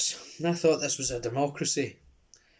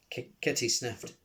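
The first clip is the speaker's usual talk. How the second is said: ordinary delivery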